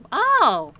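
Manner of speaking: loud sudden gasp of surprise; startled exclamation; surprised oh. Real, not acted. Sudden discovery of village.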